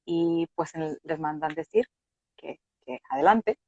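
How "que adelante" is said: normal talking voice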